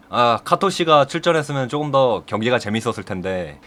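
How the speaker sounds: plain reading voice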